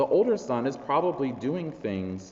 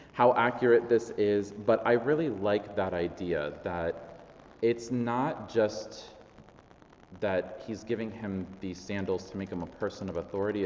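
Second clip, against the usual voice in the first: normal talking voice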